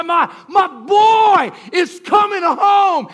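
high, slow, joyous shout of a father; overjoyed father. Acted. Prodigal's father seeing his son, shouting slow and high. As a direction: cry out his joy across the field.

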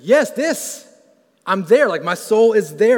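high, loud, gasping with joy; excited rapture. Retold or imitated. Psalmist catching sight of God, loud excited rapture. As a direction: burst out as the psalmist finally gets it. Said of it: "He's like".

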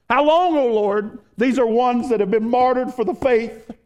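loud, high anguished cry; the martyrs' plea, voiced loud and high. Martyrs crying out to God.

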